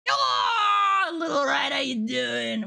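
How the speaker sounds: high, loud greeting call; a jolly bellowed hello; playful shout. Acted. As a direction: call out loud and teasing to a little rat. Calling out to a character.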